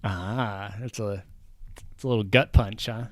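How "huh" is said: normal talking voice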